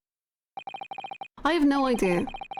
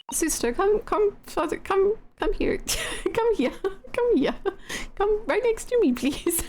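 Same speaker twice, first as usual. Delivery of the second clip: higher, coaxing as to a shy cat; coaxing the prisoner out of the dark, higher sing-song; coaxing call; 6 semitones higher than usual. Acted. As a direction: lure him closer, sing-song. Coaxing a scared prisoner closer.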